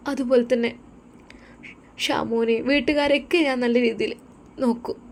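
a wife making a sincere promise; earnest young wife. Acted.

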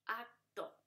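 "Acto" as ac, pause, to; "Acto" repeated in two separate beats; split into syllables. Shown as a demonstration. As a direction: break "Acto" after the c. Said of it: "C T se divide".

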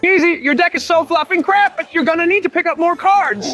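high-pitched trash talk; taunting. Acted. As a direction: needle the rival in a high voice. Trash-talking rival's deck.

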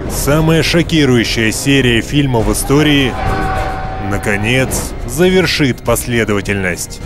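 movie trailer voice. Acted. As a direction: build hype like a trailer. Trailer narrator hypes the film.

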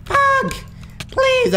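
extremely high falsetto squeals; short, piercing squeals of panic; panicked squeal. Real, not acted. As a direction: squeak each word out in terror. Dying in the game.

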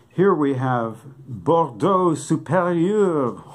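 mock-fancy presentation of the wine; grand wine presenter. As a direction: announce it like a sommelier unveiling a prize.

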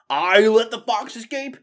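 loud, high incredulous question, rising at the end; indignant disbelief. A narrator's reading. Crony blamed for the lost fox, indignantly incredulous. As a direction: echo the charge in outrage. Accused rat throws blame back.

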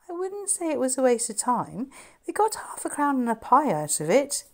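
lightly voiced boy pushing back cheerfully; Charlie. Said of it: "said Charlie".

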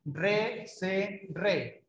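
soft spaced syllable drill; syllables one per second. Shown as a demonstration. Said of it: "una por segundo".

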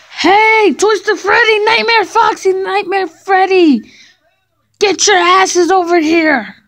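high, loud call to the group. Acted. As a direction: yell for them to come over. Calling the animatronics over.

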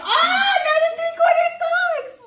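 high squealing excitement; thrilled about the advent sock; excited squeal. Real, not acted. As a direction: shriek with delight.

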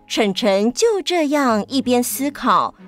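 usual speaking voice

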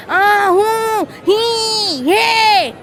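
high, loud nonsense whoops; long drawn-out playground hollers; wordless crowd yelling. A narrator's reading. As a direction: whoop like excited kids in a crowd. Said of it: "ते सगळे जोरजोरात ओरडू लागले".

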